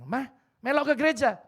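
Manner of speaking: loud, high call to come along; coaxing mother-in-law loudly to church. Retold or imitated.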